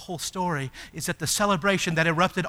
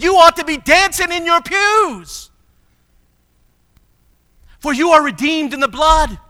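loud, higher, rousing sermon climb; preaching at full force; 6 semitones higher, 10 dB louder than usual. Acted. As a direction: stir the pews, build to a triumphant cry.

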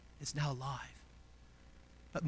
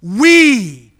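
WE drawn out and loud; strong, long stress on WE; emphatic WE; 4 semitones higher, 17 dB louder than usual. Shown as a demonstration. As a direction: hit WE hard, letting it fall. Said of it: "is emphatic".

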